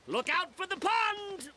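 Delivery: storybook musketeer's alarm; mock yell. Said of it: "until Porthos yelled".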